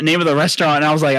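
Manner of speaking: normal talking voice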